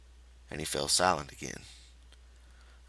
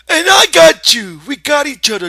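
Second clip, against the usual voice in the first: loud, high excited shout; eager demand; 19 dB louder than usual. Acted. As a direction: demand the story joyfully. Lenny joining in.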